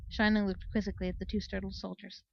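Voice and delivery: usual speaking voice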